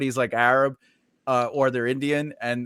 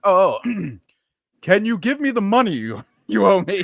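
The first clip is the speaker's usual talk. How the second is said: mock Indian accent, higher and louder; father's Indian accent; 4 semitones higher, 4 dB louder than usual. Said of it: "more like your father would talk".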